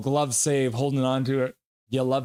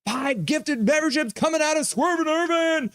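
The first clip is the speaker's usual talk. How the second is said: hype announcement; 12 semitones higher than usual. Real, not acted. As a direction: shout out the gift like an arena announcer. Hyping gifted memberships on stream.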